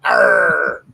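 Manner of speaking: low growling pirate Arr. Pretend. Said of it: "talk in your pirate voice".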